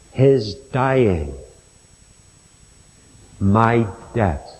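slow, solemn preaching with long pauses; earnest preaching. Acted. Preacher appealing to his listeners about the cross, slow solemn preaching. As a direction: plead with them gently, one phrase at a time.

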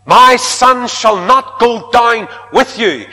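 loud, slow, high father's refusal; the old father laying down the law, slow and loud; stern father refusing. Acted. Father Jacob refusing his sons, loud and slow. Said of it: "he said".

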